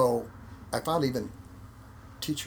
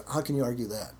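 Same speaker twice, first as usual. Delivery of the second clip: ordinary talk, nothing marked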